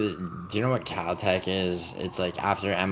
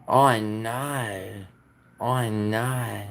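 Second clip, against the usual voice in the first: hammed-up Australian accent, drawn out slow and higher; exaggerated Australian accent; 4 semitones higher, 4 dB louder, much slower than usual. Streamer mocking an Aussie for chat in a hammed-up Australian accent. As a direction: stretch every vowel in a mock Australian accent. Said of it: "funnier to do an Australian accent, super exaggerated".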